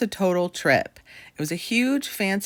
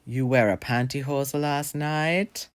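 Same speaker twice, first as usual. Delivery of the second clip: put-on slow, thick German accent as the dad; slow questioning in a German accent; 3 semitones lower, slower than usual. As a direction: girlfriend imitating the boyfriend's German dad. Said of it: "in a thick German accent".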